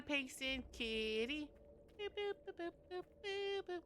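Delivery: squeaky high-pitched boops at the cat; cutesy high boops. Real, not acted. Streamer cooing boops to her cat, squeaky and high. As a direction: coo the boops up high and soft.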